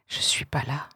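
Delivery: put-on chuchoté, accent sur pas; whisper. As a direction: souffle la phrase, appuie sur pas. Refrain murmuré du poème. (chuchotement, appui sur « pas »)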